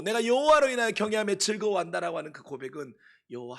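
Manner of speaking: fervent sermon climax, voice ringing high and loud; preaching at full force. Acted. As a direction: proclaim it to the whole hall, rising in fervour.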